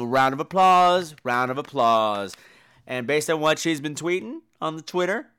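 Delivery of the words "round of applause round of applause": ordinary talk, nothing marked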